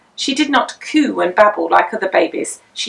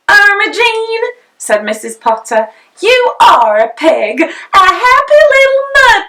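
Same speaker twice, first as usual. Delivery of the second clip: higher, louder fond declaration; a mother accepting her muddy child with delight; loving mother; 11 semitones higher, 6 dB louder than usual. A narrator's reading.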